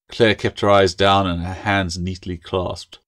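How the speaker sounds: plain reading voice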